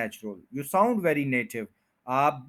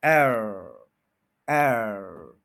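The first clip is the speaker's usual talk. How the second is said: एयर drawn out, lower and falling; stressed AIR; 5 semitones lower, 3 dB louder, much slower than usual. Shown as a demonstration. Teacher repeating the stressed syllable, long falling एयर. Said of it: "ये stress हो गया".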